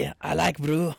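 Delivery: lightly Scottish, rolled R; a hint of mock Scottish; Scottish accent. Shown as a demonstration. Answering the co-host in a light Scottish accent. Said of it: "your Scottish one's better than me".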